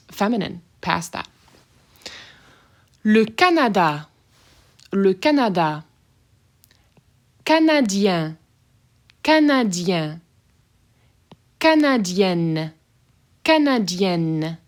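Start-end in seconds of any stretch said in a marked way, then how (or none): 3.0-14.6 Canadien with an open nasal N, Canadienne with a hard N; slow, careful Canadienne, sounding the N; French pronunciation. Shown as a demonstration. Teacher contrasting open and hard N, careful French. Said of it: "you should be able to hear the difference".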